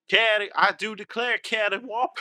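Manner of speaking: hammed-up Southern accent, pitched high; mock Southern accent trying a word out. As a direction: play a genteel Southerner. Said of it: "Doesn't sound right in a Southern accent".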